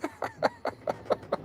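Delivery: high breathless wheezing laugh; real helpless laugh. Real, not acted. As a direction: crack up until no voice comes out. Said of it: "I've got tears rolling down my eyes".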